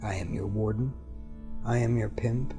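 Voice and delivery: ordinary talk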